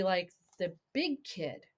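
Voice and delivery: plain reading voice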